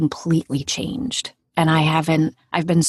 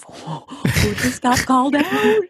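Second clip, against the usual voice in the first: high, gleeful mock shock; slow, rising squeal of scandalized amusement; amused disbelief; 7 semitones higher than usual. Patient watching someone get called out, high mock shock. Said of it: "I snorted laughing 'cause I thought to myself".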